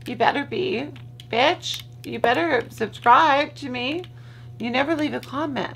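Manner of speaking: high mock bossy warning; playful scolding. Teasing her friend Sarah to subscribe, high and bossy. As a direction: scold your friend in jest.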